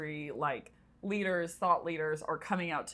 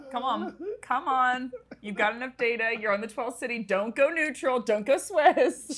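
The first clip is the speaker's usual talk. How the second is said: playful pushing; 6 semitones higher, 6 dB louder than usual. Real, not acted. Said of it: "pushing to rank".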